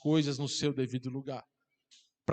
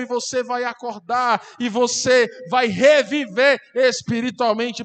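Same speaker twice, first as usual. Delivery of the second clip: loud, higher, driving sermon climax; preaching at full force; 6 semitones higher, 9 dB louder than usual. Real, not acted. Preacher urging worshippers to take communion and revive, full-force preaching. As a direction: thunder the promise out to the congregation.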